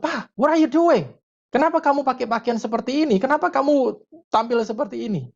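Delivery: high, dismayed kid imitation; shocked teenager. Embarrassed teenager confronting punk-dressed Dad, shocked high-pitched questioning. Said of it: "Anaknya lihat ini kaget".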